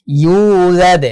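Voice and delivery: "ইউলাদ" with a long held "yuu" and bounced d; slow, drawn-out "ইউলাদ"; stretched vowel, qalqalah. Shown as a demonstration. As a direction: stretch the start of "ইউলাদ", echo its final d. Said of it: "একালিফ টেনে পড়তে হবে".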